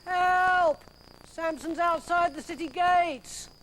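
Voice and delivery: high, drawn-out cry for help; panicked cry. Acted. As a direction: call the alarm, desperate. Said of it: "cried the gatekeeper".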